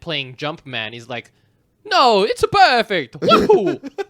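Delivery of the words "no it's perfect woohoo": high, loud, slow Mario voice; cheery Italian plumber voice, slowed down. Retold or imitated. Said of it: "That's Charles Martinet doing a Mario voice".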